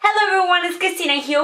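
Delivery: ordinary delivery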